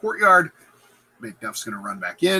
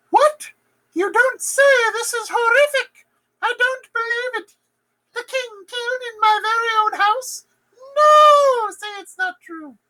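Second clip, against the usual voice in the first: much higher, loud, fake disbelief; overacted shock; 5 dB louder than usual. Lady Macbeth faking shock at the murder, overacted falsetto. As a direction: ham up shock like a bad soap actress. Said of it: "Lady Macbeth".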